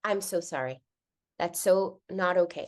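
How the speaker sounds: low, soft, simple apology; tender motherly validation, voice dropped; sincere apology. Retold or imitated. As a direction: apologize quietly and gently. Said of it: "This time she just was like".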